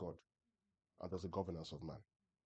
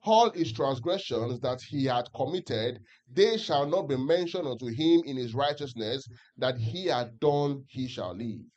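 slow, louder unison reading, every word spaced; louder group scripture reading; 3 semitones higher, 10 dB louder, slower than usual. Acted. Preacher leading the church in reading Ezekiel aloud, slow and louder. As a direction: read it out together slowly, in unison. Congregation reads together.

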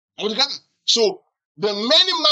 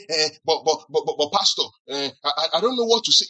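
hesitant, stuttering protest; mock timid member fumbling for an answer; stammering excuse; 3 semitones lower than usual. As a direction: stumble on the first words, then rush it out. Imitating a timid excuse to Pastor.

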